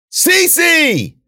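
high, loud shout of a name; drawn-out searching holler; calling out. A narrator's reading. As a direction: yell for someone lost in the woods. Calling for missing companion.

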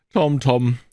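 chiding aunt; reproachful. Acted. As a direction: say his name in disappointment.

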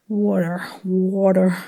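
slow American "water", practised carefully; American accent. Shown as a demonstration. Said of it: "a hard word in American".

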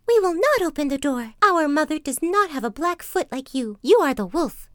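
put-on high, loud refusal in little kids' voices; kids crying out. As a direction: cry out defiantly, high and loud. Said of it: "cried out".

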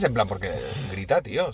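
ordinary talk, nothing marked

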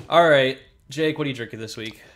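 normal talking voice

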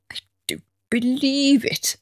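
put-on whispered grumpy old man; exasperated whisper of a grumpy pensioner; Victor Meldrew. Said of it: "Victor Meldrew kind of".

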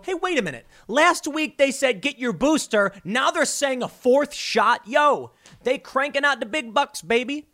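mock regular guy. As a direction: play a fed-up guy on the street calling it out. Voicing regular people catching on.

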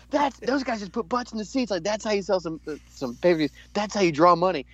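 high, excited Taker boast; hyped Taker impression. Taker hyped backstage, high and excited. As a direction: crow it fired up. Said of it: "He's like".

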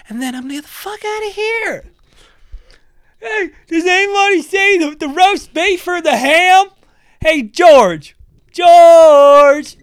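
mock shopper lady. Shopper in his way asks for onions, hammed-up high voice. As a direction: ham up a lost shopper calling out. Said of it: "fat Sandy from Ohio get in my way and go".

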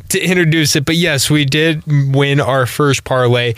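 ordinary talk

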